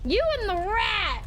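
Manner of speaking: indignant accusing yell; outraged accusation. Real, not acted. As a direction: hurl the accusation up high. Repeats accusation, higher.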